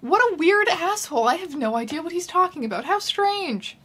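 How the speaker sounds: high mock-indignant heroine; mock Harriet. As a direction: play her as baffled and exasperated. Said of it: "Harriet is like".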